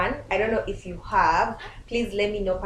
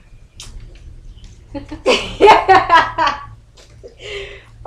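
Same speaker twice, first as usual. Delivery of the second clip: long, high, loud burst of laughter; big laughing fit; 10 semitones higher, 11 dB louder than usual. Real, not acted. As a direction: crack up and keep laughing. Cracks up after the answer.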